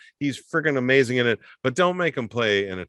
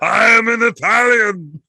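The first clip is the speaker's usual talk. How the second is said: put-on higher, louder, slow shouted Austrian-accented line; Arnold as Italian; 10 semitones higher, 8 dB louder than usual. Arnold in Raw Deal claiming to be Italian, loud slow mock Austrian. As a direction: bellow it as Arnold badly playing an Italian. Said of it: "he's like".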